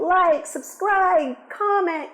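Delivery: high, loud, slow, stretched-out call to action; hammed-up plea. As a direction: sing out each request to the viewers. Hammed-up sign-off call to viewers.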